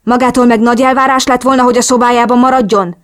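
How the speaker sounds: acted exasperated outburst; angry berating. A narrator's reading. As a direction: throw an indignant rebuke at her. Enraged Tony berates the narrator.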